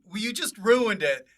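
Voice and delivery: ordinary talk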